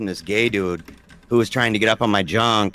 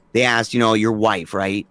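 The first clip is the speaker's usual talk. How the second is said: ordinary talk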